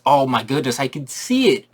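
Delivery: usual speaking voice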